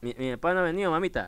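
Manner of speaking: soft phone reply. Real, not acted. Son talks softly to mother on phone.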